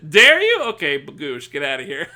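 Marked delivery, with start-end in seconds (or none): 0.1-0.6 high, loud mock outrage; outraged yell at a chatter; real yell. Real, not acted. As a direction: fling it at him. Yelling at chatter.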